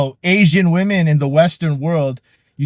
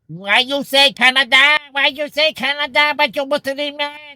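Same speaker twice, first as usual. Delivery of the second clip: much higher, squeaky put-on indignant voice; angry girl caught out by the lie, in a high mock voice; 11 semitones higher than usual. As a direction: squeal the accusation like an offended girl. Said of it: "And they would get angry".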